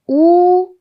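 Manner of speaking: 우 held long and high with rounded lips; held rounded vowel. Shown as a demonstration. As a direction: sustain a loud, rounded 우. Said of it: "입술 모양은 우를 유지하시고".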